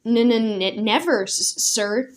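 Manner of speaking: nervous stutter on every word. Acted. Knight stammering before the commander, nervous stutter. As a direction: stammer it out, scared. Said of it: "Sir Bumpkin stuttered".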